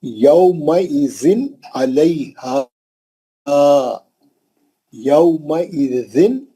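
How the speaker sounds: slow, measured Quran recitation; careful tajweed demonstration; example recitation. Shown as a demonstration. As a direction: recite syllable by syllable with long vowels. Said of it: "पांचवी मिसाल है".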